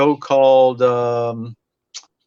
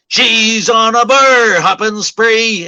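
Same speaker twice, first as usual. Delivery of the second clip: high, loud and slowed, the line hammered home; 8 semitones higher, 5 dB louder, much slower than usual. Retold or imitated. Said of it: "he just clobbers you with it".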